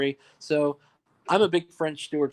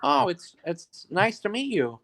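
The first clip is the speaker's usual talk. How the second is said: lightly voiced friendly greeting, higher, slow; a hint of a polite actor meeting a fan; French Stewart; 5 semitones higher than usual. Actor greets the storyteller, lightly mimicked and slow. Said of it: "he's like".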